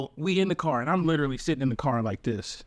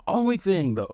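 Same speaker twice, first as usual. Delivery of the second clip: usual speaking voice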